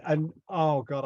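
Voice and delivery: usual speaking voice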